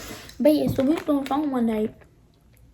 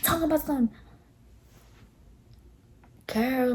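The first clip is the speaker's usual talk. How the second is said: usual speaking voice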